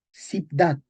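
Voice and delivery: Ciudad said as tsib-dad; medieval pronunciation. Shown as a demonstration. Teacher reading out an old word, medieval pronunciation. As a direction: start Ciudad with ts, a b before the d. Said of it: "Se pronuncia ts".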